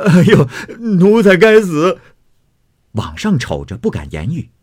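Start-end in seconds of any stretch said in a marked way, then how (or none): 0.1-2.0 put-on high, loud cry of panic from the servant boy; frightened servant. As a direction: yelp it out while kowtowing in fear. 星儿忙又磕头说. (小厮惊叫认罪)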